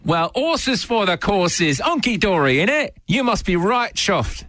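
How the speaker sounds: put-on British accent. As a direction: lay the British accent on thick. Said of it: "we're on British terminology".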